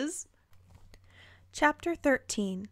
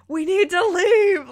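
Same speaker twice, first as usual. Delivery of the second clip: much higher, louder slow yell; 8 semitones higher, 11 dB louder than usual. A narrator's reading. Narrator voicing Lewis yelling, much higher and louder. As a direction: shout urgently. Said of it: "Lewis yells".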